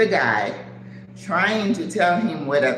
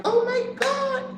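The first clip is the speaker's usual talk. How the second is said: very high squealed exclamation; overwhelmed amazement. Retold or imitated. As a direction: act floored, way up in pitch. Retells being floored by offer.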